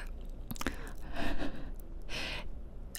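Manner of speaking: hushed, breath-only giggling; long whispered laugh. Real, not acted. Gamer amused while telling her dragon mishap, whispered giggling. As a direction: laugh on and on without voice.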